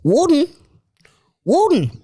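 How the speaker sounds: broad Australian impression; Chopper's Australian accent. Asked for an accent by mates, Australian Chopper impression. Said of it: "I'll do Chopper then".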